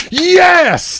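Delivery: big, high, loud burst of joy; excited yes. Retold or imitated. Host hearing pecan pie beer, excited yell. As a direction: shout it like the best news ever. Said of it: "Well, for me, I'm like".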